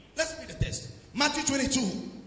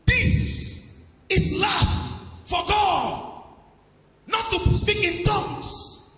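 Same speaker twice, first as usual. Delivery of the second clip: slow, high shouting sermon cadence; full-force preaching; 6 semitones higher, slower than usual. Real, not acted. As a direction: hammer each line home to the church.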